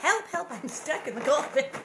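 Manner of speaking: hammed-up help call; mock cry for help.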